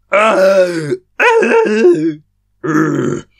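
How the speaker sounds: high, loud pained screams. Acted. Runners hurled by explosion, loud pained screams. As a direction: thrown to the floor by a blast. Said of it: "They screamed".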